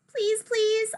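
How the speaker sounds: high-pitched pleading; desperate little beg; begging. A narrator's reading. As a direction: plead for it like a kid. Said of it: "I beg".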